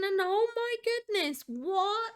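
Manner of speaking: high, delighted shock; surprised gasp. Real, not acted. Judge gasps at Zara's submission, high and surprised. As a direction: gasp in disbelief at the build.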